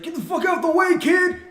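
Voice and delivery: high, loud imitated yell; screaming. Crackhead on a bike to a kid in his way, screaming. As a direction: yell it like an angry man swerving at you. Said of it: "screaming at me".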